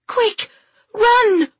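very high, crying shout; urgent cry. Acted. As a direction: cry out for him to run. Urging Alfie to flee.

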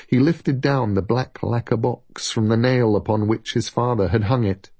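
ordinary delivery

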